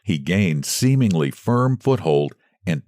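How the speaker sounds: ordinary delivery, nothing marked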